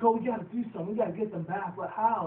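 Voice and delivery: high, loud excited scheming; mock eager pony; eager filly voice. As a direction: plot it like a keyed-up kid. Fillies scheming in scene.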